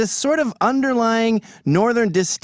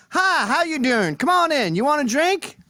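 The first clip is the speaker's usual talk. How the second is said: friendly Southerner; 9 semitones higher than usual. Retold or imitated. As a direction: greet them like a cheerful Southern host. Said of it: "and they go".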